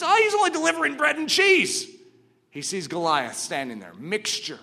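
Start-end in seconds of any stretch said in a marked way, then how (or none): 0.0-1.9 mocking sneer. Brothers mocking David.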